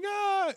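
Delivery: very high cheering shout; imitated crowd cheer. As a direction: scream like an excited crowd. Four hundred people cheering.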